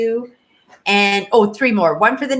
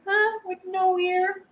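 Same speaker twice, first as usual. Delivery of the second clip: much higher, softer pet-talk coo; 8 semitones higher, 6 dB quieter than usual. Real, not acted. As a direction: sweet-talk the cat up close. Cooing to her cat.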